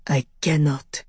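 low, strained character voice; a touch of harshness, held low; low, harsh. A narrator's reading. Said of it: "Jean-Claude's voice came low and harsh".

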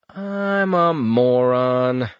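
slow, drawn-out inner groan; self-scolding thought. Acted. Richter scolding himself in thought, slow and drawn out. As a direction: drag it out like kicking yourself.

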